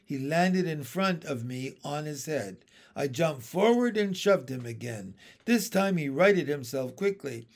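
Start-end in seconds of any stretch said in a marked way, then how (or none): none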